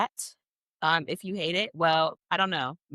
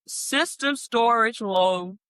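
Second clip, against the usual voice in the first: slow, higher-pitched computer warning; dreaded alert; 4 semitones higher, much slower than usual. Retold or imitated. Sims player retelling her laptop's warning, slow dreaded alert. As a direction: announce it like an ominous notification.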